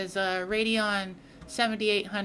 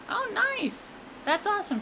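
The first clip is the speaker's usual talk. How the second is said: excited; 12 semitones higher than usual. Real, not acted. Reacting to a chat message.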